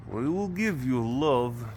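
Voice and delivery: put-on slow, lightly done Russian accent; a touch of Russian, drawn out. Vlogger voicing the cat, lightly in a Russian accent. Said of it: "in a Russian accent".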